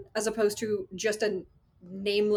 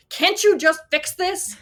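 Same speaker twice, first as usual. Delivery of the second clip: high, loud, exasperated mock demand; imitating a pushy director's outburst; the director snapping at the new associate, a loud mock yell; impatient demand; 11 semitones higher, 10 dB louder, slower than usual. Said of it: "She's yelling at me".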